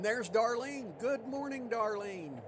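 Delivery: high, bright sing-song hello; cheerful greeting. Real, not acted. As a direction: greet a friend arriving, lifted. Greeting a viewer in chat.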